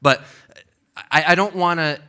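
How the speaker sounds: ordinary delivery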